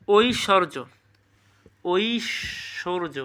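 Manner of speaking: ঐশ্বর্য drawn out very slowly, syllable by syllable, as oi-shor-jo; careful pronunciation. Shown as a demonstration. Teacher drilling students on exam words, ঐশ্বর্য pronounced slowly. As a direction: sound out ঐশ্বর্য slowly and clearly for the class. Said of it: "সঠিক উচ্চারণ কী হবে বলো?".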